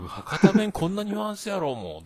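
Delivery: high-pitched Hakata retort, falling away at the end; exasperated protest. Retold or imitated. Husband defending his dialect to his wife, exasperated protest. Acted protest to wife's rebuke.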